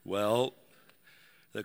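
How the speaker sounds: a hint of an old man telling his story. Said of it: "that's my old man voice".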